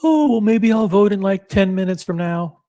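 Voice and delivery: high, sing-song mock of a lazy viewer; mocking lazy voter. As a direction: parody a slacker putting it off. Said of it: "waste time waiting for you guys".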